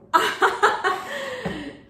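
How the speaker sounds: high, loud burst of laughs; delighted laughter. Real, not acted. Unboxer overwhelmed by the gift, delighted laughter. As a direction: laugh in real disbelief.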